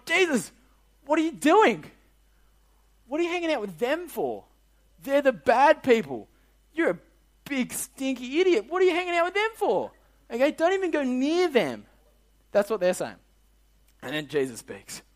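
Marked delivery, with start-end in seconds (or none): 0.0-11.9 high-pitched, hammed-up outrage; exaggerated high complaint; mock indignant scolding. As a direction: scold like a scandalised busybody, in a silly high voice. Acting out the Pharisees scolding Jesus.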